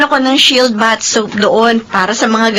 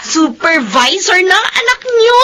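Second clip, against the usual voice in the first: excited; 8 semitones higher than usual. Acted. Announcing promotion.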